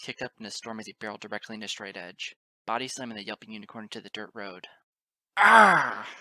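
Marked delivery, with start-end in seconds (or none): 5.4-6.1 very loud, high cry of impact; pained yell. A narrator's reading. Father body-slammed to the road, loud pained yell.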